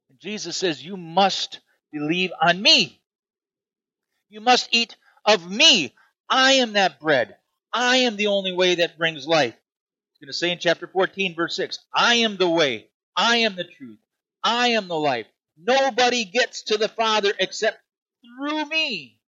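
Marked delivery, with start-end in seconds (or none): 0.8-9.6 impassioned sermon warning; forceful preaching. Acted. Preaching Jesus' words at full force. 11.9-19.2 climbs higher to a peak on me; soaring preaching. Acted. Preaching Jesus' words, rising.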